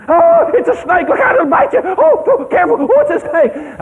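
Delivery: high, shrill-pitched panicked cries; hammed-up city folks panicking at a snake; mock screaming. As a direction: squeal like startled city picnickers. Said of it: "two or three of 'em were screaming".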